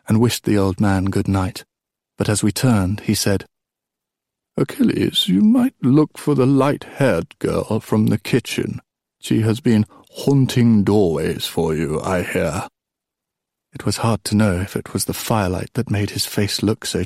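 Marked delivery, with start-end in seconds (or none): 4.6-12.7 put-on old king, suggestive. Old king nudging Achilles toward a girl, suggestive. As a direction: stretch out the knowing hint.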